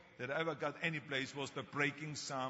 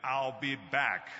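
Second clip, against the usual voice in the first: slow, deliberate, loud robotic "I'll be back"; movie catchphrase quoted, slow and machine-like; Terminator line; 6 dB louder, much slower than usual. Retold or imitated. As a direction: deliver it like the Terminator, slowly. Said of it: "when I did Terminator".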